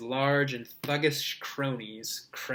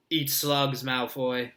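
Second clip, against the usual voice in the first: ordinary delivery, nothing marked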